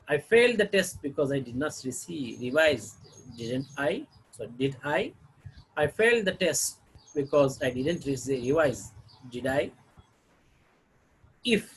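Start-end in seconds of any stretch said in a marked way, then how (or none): none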